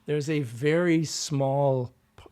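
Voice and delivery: usual speaking voice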